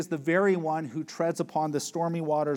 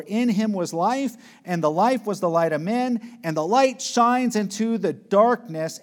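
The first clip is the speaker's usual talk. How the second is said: preaching at full force; 5 semitones higher, 6 dB louder than usual. Acted. Preacher proclaiming scripture to the congregation, loud and higher.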